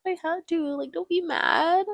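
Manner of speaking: high, drawn-out plea; mock pleading. Ben explains himself to Mrs. Robinson, high mock pleading.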